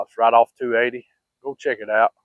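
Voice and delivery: ordinary delivery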